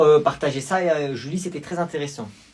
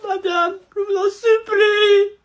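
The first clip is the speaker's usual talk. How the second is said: high, slow, tearful wail; crying plea; 14 semitones higher, slower than usual. Acted. Pupil crying to the teacher in a high, slow wail. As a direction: wail the plea through tears. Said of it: "J'ai jamais vu un élève pleurer".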